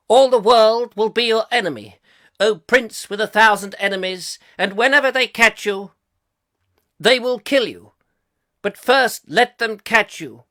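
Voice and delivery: hammed-up theatrical verse delivery; Shakespearean declamation. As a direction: declaim it grandly, pausing between phrases. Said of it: "the master of Shakespeare".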